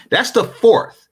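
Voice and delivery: normal talking voice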